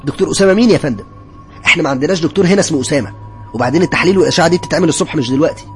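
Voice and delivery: irritated. A narrator's reading. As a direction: brush off an unreasonable visitor testily. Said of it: "رد عليا بعصبية وقال لي". (رد بعصبية)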